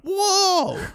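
high, astonished whoa; surprised whoa. Retold or imitated. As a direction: gasp it out in amazement. Reaction to seeing video.

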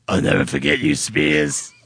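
put-on character voice, low and quick; Steve's mustache. Mustache bidding its old owner farewell, put-on voice. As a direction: play a talking guest in a silly voice. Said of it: "Steve's mustache from freshman year".

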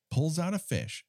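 normal talking voice

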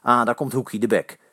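ordinary delivery

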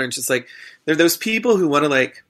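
ordinary talk, nothing marked

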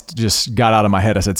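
ordinary talk, nothing marked

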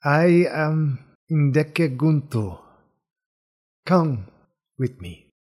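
slow, measured bird-man introduction; bird-man character. Acted.

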